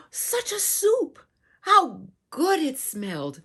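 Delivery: high amazed delight; amazed gasp. Pretend. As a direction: gasp then marvel at it. Marvelling at the soup.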